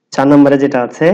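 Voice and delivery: normal talking voice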